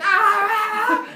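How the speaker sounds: long, very high, loud wild scream. Retold or imitated. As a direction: scream wildly mid-fight. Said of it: "and me screaming".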